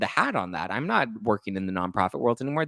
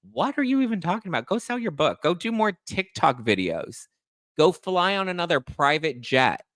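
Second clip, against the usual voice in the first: retold comeback; 7 semitones higher than usual. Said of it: "I was like".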